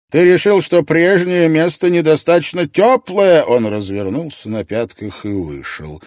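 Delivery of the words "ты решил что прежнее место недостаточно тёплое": loud, high, scornful rant; furious roar. A narrator's reading. Ott rages at Gref before storming out, furious roar. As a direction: keep roaring at full anger. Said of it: "Отт ревел".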